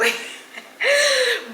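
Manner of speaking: a sudden high laugh that falls away; high burst of laughter. Real, not acted. Laughs at her own joke.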